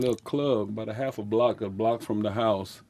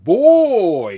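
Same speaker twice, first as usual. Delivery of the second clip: put-on louder, higher exclaimed "Boy"; father's exclamation; 9 semitones higher, 12 dB louder than usual. Creole father exclaims to his wife, louder and higher. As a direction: call out "Boy" in surprise. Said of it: "He said".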